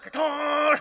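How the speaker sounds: high, drawn-out revving noise; imitated engine roar. Pastor putting his foot down, imitated engine roar. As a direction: mimic a sports car flooring it. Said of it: "Like one of those".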